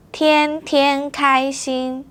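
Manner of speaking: 天天开心 slow and even; slow model. Shown as a demonstration. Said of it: "now it's your turn".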